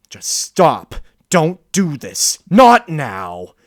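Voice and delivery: fighting for control, crying out; desperate loud plea. Acted. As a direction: shout to stop the beast inside. Pleading with the beast.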